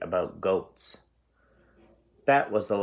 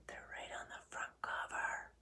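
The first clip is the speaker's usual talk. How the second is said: soft secret whisper; whispered hint; 18 dB quieter than usual. Real, not acted. Teacher whispering a hint to the child. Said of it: "I'll give you a hint".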